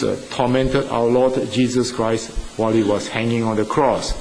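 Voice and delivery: usual speaking voice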